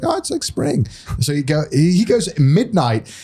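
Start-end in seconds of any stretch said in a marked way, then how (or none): 0.0-0.9 much higher, breezy mimicry; tossed-off answer in a high voice; imitating Mario. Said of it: "He's like".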